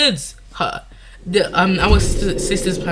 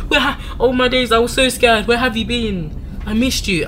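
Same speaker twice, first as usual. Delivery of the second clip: higher, louder mock-relieved mom; imagined relieved mother; 6 semitones higher, 3 dB louder than usual. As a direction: gush like a worried mother finding her kids. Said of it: "A normal mother would be like".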